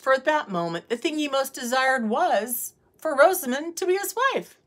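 usual speaking voice

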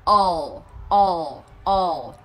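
"ồ" as English all, long and falling into L; final dark L. Shown as a demonstration. Teacher repeats for learners, "ồ" closing on dark L. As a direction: let the tongue curl up at the end of "ồ". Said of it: "Lưỡi cứ cong lên là được".

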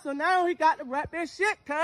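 ordinary talk, nothing marked

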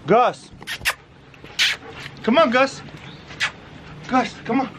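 high call to a dog. Real, not acted. Calling his dog.

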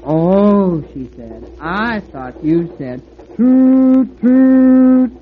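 flattering gush with a mock toot. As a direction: gush insincerely, hooting toot high. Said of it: "gushing over locomotives in a most insincere manner".

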